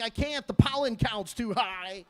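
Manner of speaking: high-pitched, comic excuse; mock allergy wimp. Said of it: "can't be a tough guy with allergies".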